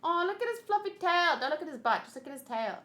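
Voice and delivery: ordinary delivery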